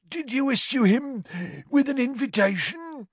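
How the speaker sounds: high, eager caretaker voice; a spiteful tattletale, pleased; gleeful Filch. Acted. Caretaker reporting Malfoy to Slughorn, high and gleeful.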